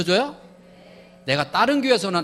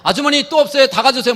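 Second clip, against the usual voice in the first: high, loud eager neighbor man; pitched up, loud and keen; suddenly eager helper; 5 semitones higher, 7 dB louder than usual. Acted. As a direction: play a flattered man rushing to help. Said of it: "이 남자가 갑자기 육각수로 바뀝니다".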